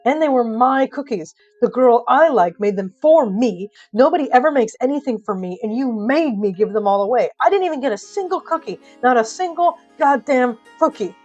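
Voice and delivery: loud, rising aggrieved rant; exasperated, wronged tirade; angry outburst. Acted. Bridger's outburst over cookies.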